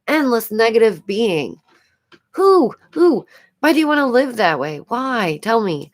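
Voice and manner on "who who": high, loud owl "who"; owl-like hoot. Real, not acted. Vlogger demanding answers, hooting "who" like an owl. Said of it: "I'm starting to sound like an owl over here".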